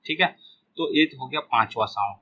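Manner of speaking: usual speaking voice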